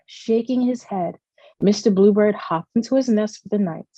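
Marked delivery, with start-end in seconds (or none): none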